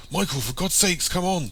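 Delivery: high, exasperated urging; urgent plea. A narrator's reading. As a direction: urge them to hurry, pitch well up. Urging friend to flee.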